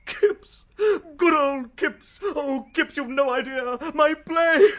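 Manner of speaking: loud, high, joyous greeting; bubbling, emotional delight; overjoyed. Acted. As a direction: gush over your old friend. Overjoyed actor greeting friend.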